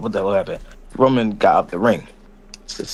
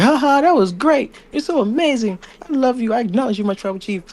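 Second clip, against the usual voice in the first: much higher, gushing falsetto; fawning sidekick. Retold or imitated. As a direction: suck up shamelessly. Heyman fawning over Roman.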